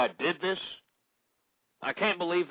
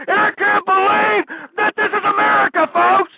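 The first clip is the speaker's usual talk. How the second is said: a host losing it on air, screaming higher and higher; rising panicked rant; 12 dB louder than usual. Real, not acted. Radio host panicking on air, shouting in a frenzy. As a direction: pitch climbing way up into a frantic, loud yell. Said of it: "I'm freaking out".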